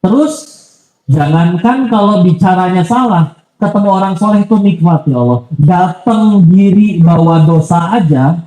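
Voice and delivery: ordinary delivery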